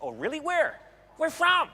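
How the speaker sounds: eager, high-pitched curiosity; jumping up high on each question; excited stranger. Retold or imitated. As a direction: imitate an excited American stranger. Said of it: "He's really excited, this guy".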